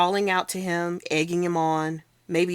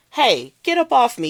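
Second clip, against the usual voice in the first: higher, louder indignant yell; angry protest; 7 semitones higher, 7 dB louder than usual. Acted. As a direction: shove back with the voice. Kid squirming in grip.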